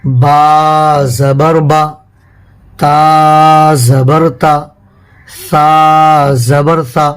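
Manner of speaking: each syllable stretched out slowly; teacher demonstrating slow Qaida reading; slow spelling demo. Shown as a demonstration. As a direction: model the reading method slowly. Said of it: "اس کو پڑھنے کا طریقہ رہے گا جیسے".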